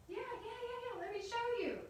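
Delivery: very high, squeaky-eager voice; excited high-pitched Jack; eager boy. Acted. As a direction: pipe up, bursting to show off. Jack eager to show Mama.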